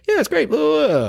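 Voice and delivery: high-pitched mock babble; high, dismissive mimicry; mocking client imitation. Mimicking the client brushing him off.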